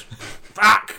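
sudden high mock yelp; pained outburst. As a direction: curse sharply as the dead leg hits. Leg gone dead in acted scene.

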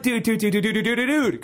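rapid high panicked repeats; panicked pleading. Retold or imitated. Cornered player begging the axe man, high panic. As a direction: beg frantically in a high squeal. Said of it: "And I'm like".

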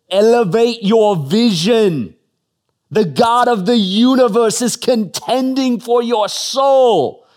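put-on slow, loud, booming Australian preacher accent. Preacher quoting a favorite Australian preacher in Australian accent. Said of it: "my best crack at an Australian accent".

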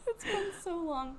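high excited squeal. Pretend. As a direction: gush with delight. Excited reunion greeting.

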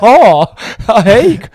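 put-on old man brightening up at the door; grandpa's surprised greeting. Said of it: "Han bara".

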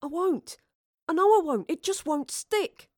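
frustrated. Acted. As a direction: fret that the lines won't stick. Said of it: "Thomas countered in frustration".

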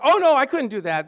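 high, loud mimicked hygienist; bubbly protest. Hygienist declining to join the church dancers, bubbly mock protest. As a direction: imitate an over-cheerful refusal.

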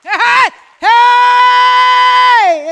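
long, high, loud preacher's cry; drawn-out shout. Real, not acted. Preacher rallying the congregation with a loud drawn-out shout. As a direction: stretch out a rousing hey to the church.

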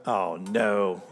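low, slow "oh no" of dread; retold dismayed reaction; sinking dread. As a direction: groan it inwardly as trouble dawns.

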